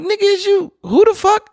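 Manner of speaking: very high, strained indignant outburst; storyteller voicing his fury at the rude man; furious disbelief. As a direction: voice cracking with outrage. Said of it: "And I'm like".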